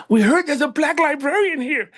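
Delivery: high-pitched eager chatter, imitated; mock wide-eyed excitement; excited young people. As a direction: voice them high and thrilled. Young people's eager reply acted out.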